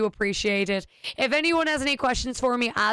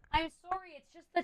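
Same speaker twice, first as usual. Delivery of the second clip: much higher and far softer, almost breathed; soft apology to the cat; 7 semitones higher, 10 dB quieter than usual. Real, not acted. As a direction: murmur sorry up high to a pet.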